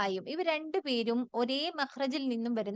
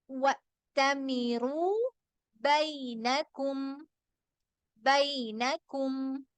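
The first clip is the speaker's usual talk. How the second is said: slow Quran recitation; much slower than usual. Shown as a demonstration. Recitation demo.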